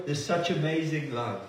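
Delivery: usual speaking voice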